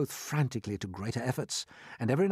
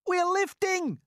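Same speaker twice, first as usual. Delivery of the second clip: high, loud excited cry; shouted; 6 dB louder, slower than usual. A narrator's reading. Someone shouting that the peach is lifting. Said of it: "somebody shouted".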